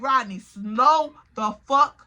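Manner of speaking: slow, emphatic telling-off; retold exasperated order. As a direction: stretch it out, pausing before the last word.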